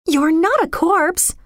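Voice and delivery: put-on high, slow, horrified girl; aghast high-pitched denial; horrified protest. A narrator's reading. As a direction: protest in horror, drawing it out. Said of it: "she protested in horror".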